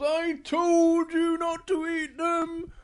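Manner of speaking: high, slow told-you-so; scolding Big Bear. Acted. Big Bear to sick Little Bear, scolding. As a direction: stretch out an exasperated reproach. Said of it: "Big Bear goes".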